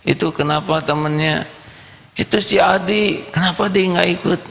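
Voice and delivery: put-on high curious kid voice; child's voice. Boy asks his mother about his friend, high child voice. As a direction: ask like a small child.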